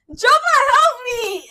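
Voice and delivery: high, loud playful yell for help; hammed-up call for rescue; mock cry for help. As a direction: shout it up high like you're floating away.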